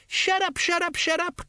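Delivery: very high strained groan; squeezed out under heavy strain; groaning shut up. A narrator's reading. Sergeant strains at the bow, silencing Nobby in a high strained groan. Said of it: "groaned Colon".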